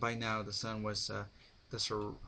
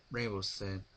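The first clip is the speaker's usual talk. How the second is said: ordinary delivery, nothing marked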